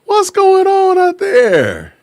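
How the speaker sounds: high, slow, drawn-out holler; calling out. Real, not acted. Host shouting out to his Naperville viewers, high and drawn out. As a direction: stretch it out like a shout across a field.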